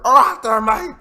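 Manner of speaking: high, loud mangled Aussie slur; bad drunk pirate impression. Host attempting the drunk Aussie voice, terrible high loud slur. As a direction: ham up a drunk pirate underwater. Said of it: "Oh, mine was terrible".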